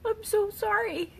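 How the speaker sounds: crying, voice pitched far up; high, tear-choked sorry; sobbing apology. Real, not acted. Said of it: "I was, like, crying".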